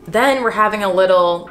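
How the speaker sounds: normal talking voice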